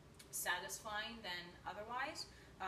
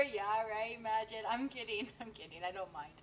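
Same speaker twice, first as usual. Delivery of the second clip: normal talking voice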